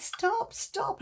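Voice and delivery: high pleading stop, stop; pleading cry. Acted. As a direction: beg the potter to stop, rising high. Cup begs potter to stop.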